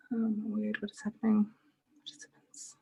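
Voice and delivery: real breathy whisper under her breath; whisper to self. Real, not acted. Host overwhelmed by the waiting participants, whispering to herself. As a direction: whisper it to yourself, flustered.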